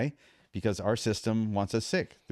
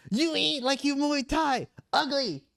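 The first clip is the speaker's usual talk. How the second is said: put-on much higher, slow Thai-accented scolding; imitating Thai trainer. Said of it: "He goes".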